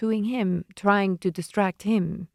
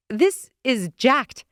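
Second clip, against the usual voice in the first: exasperated; 5 semitones higher, 4 dB louder, slower than usual. Acted. Narrator upset.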